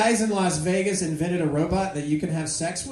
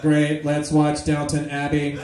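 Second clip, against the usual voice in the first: slow, low monotone machine; mechanical robot voice; 4 semitones lower, slower than usual. Acted. Robot wins the night in, slow mechanical monotone. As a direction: drone it slowly with no inflection. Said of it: "that same mechanical voice".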